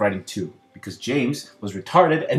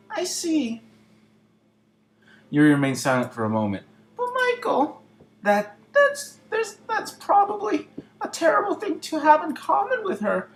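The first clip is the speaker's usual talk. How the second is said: put-on high flustered girl voice. Voicing Yuri, flustered.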